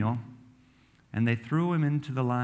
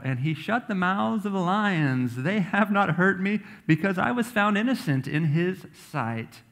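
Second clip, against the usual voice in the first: earnest Daniel; 7 semitones higher, 4 dB louder than usual. Acted. Daniel addressing the king.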